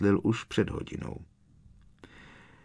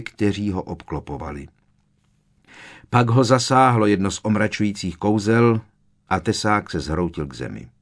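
ordinary delivery

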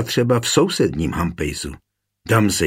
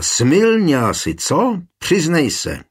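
high-pitched narrated shout, not full volume; 7 semitones higher, 3 dB louder, slower than usual. A narrator's reading. Husband accusing his fallen wife, high half-held shout. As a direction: press for a confession, pitch jumping up. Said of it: "dál křičel".

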